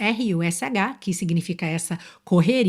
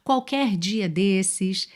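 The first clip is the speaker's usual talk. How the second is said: slow, careful example phrases; slow and clear; much slower than usual. Shown as a demonstration. Teacher giving common phrases, slowly. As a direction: spell out each phrase slowly. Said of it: "frases muito comuns".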